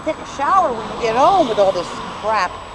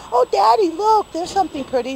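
put-on little kid voice; 10 semitones higher than usual. Voicing an imagined little kid.

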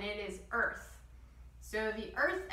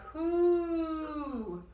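candle-blowing hoo; 7 semitones higher, 5 dB louder than usual. Shown as a demonstration. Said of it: "like we're blowing out a candle".

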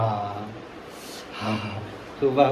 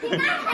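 high laugh; 5 dB quieter than usual. Real, not acted. Laughing at the scolding.

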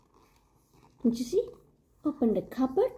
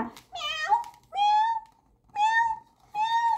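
very high kitten meows; rising high meows; cat meows. Retold or imitated. Imitating cats.

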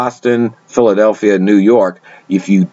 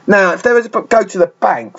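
South London accent; 9 semitones higher than usual. Shown as a demonstration. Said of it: "generic South London accent".

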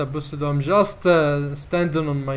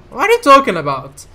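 higher, louder, fast what are you talking about; incredulous outburst; 7 semitones higher, 10 dB louder, faster than usual. Real, not acted. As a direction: fire it back in bewilderment. Baffled by Hamlet quote.

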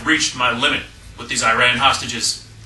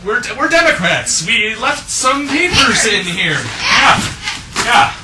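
fake innocent voice; 9 semitones higher, 3 dB louder than usual. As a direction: act innocent too hard. Spies pretending to be Democrats.

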